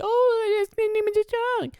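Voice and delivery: squeaky high mocking voice. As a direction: mimic a silly high voice. Mocking chat viewer voice.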